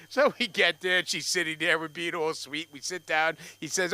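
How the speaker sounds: usual speaking voice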